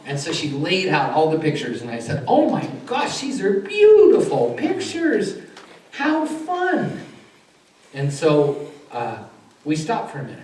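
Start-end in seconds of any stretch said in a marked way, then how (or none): 2.3-7.0 very high, slow, loud cooing admiration; gushing delight. Retold or imitated. Therapist admiring the children's sister pictures, gushing delight. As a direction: gush high and stretch it out as for small children.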